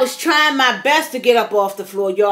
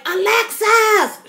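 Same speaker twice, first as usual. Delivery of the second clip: calling out her name; 7 semitones higher, slower than usual. Retold or imitated. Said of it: "And I was calling Alexis".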